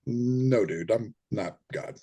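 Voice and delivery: a calm, amused guide shrugging off "God"; laid-back spirit guide. Retold or imitated. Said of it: "He goes".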